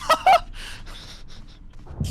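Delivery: squealing, loud laugh; high burst of laughter. Real, not acted. Cracking up at the game.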